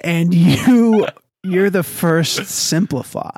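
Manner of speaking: loud, slow declaration; hammed-up loud breakup verdict; mock pronouncement. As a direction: announce it grandly. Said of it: "That was my attitude".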